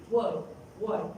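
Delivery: "word" with a kissed, rounded W; WORD said as a demonstration of W; rounded-lip W. Shown as a demonstration. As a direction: bring the lips together to start "word". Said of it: "it's called kiss your".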